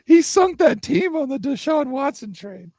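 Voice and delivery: high-pitched, indignant sports rant; heated outburst. Real, not acted. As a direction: blurt it out high in real indignation. Heated rant about Browns GM.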